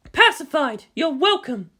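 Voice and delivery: Misato's voice. A narrator's reading.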